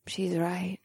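soft seductive purr. Acted. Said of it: "Vesta purred".

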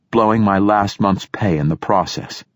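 ordinary delivery

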